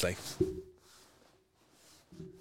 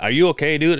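higher, louder irritated question; acted-out annoyance, pushed up and louder; annoyed; 6 semitones higher, 5 dB louder than usual. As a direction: retell it as an impatient challenge.

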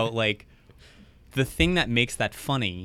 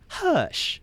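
high-pitched impression of a sassy hush; mock female voice, very high. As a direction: imitate the comedian's lady voice, pitched way up.

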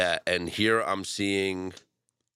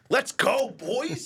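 high excited cheer; frat bro; 13 semitones higher, much slower than usual. Real, not acted. Bet placed, cheering.

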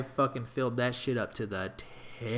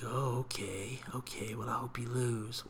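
softer hiss of fake niceness; snide whisper; 3 semitones lower, 8 dB quieter than usual. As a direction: whisper it like a spiteful rival. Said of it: "A lot of people, like".

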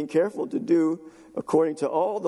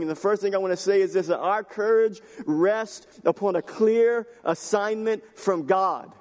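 higher, forceful sermon delivery; impassioned preaching; 5 semitones higher than usual. Real, not acted. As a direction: drive each phrase home to the congregation.

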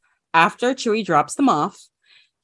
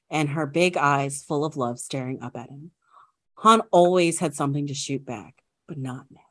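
hushed nature documentary; 3 semitones lower than usual. Acted. Reads fan fiction aloud.

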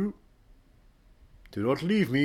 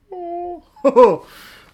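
loud, high falling cackle; high manic laugh; 11 dB louder than usual. Pretend. High, loud laugh at the cohost he came back to pester.

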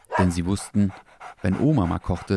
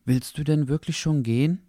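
put-on higher boy's voice, hopeful; a boy hoping the fox will stay; young boy asking; 7 semitones higher than usual. Henry asks the fox. (hoffnungsvolle Jungenstimme)

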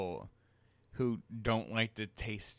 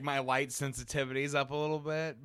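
ordinary talk

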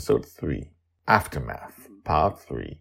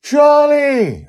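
very high, loud cry of his name; almost scream it; near scream; 15 dB louder than usual. Acted. Amali finding Charlie alive, near scream. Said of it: "Amali almost screams".

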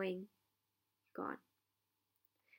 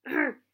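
pained cry; 5 semitones higher, 7 dB louder than usual. Acted. Hagrid bitten on the leg.

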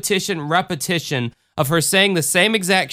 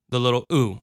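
ordinary delivery

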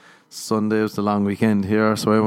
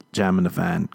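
usual speaking voice

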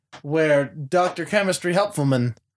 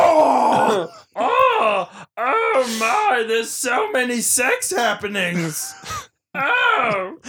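mock moaning; 8 semitones higher, much slower than usual. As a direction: overdo breathless sex moans. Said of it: "Hottest dirty talk in the biz".

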